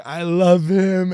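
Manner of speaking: high, loud, slow wailing fan; mock crying fan. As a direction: wail it out like a drunk fan in tears. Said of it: "she was crying in the bathroom".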